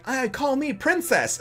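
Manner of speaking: high, slow, dainty mock voice; middle head's princess. Middle one of three dudes naming himself, high and girlish. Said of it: "the middle one's like".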